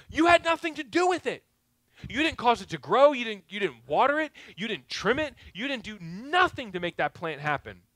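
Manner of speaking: God rebuking. Acted. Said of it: "God says".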